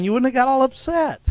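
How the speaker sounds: usual speaking voice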